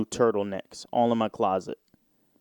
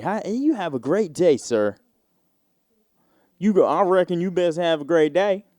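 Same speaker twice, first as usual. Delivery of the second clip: Southern greeter voice; 5 semitones higher than usual. Retold or imitated. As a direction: play an overly cheerful Southern door greeter. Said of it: "but the Southern greeters".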